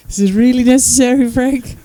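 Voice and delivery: usual speaking voice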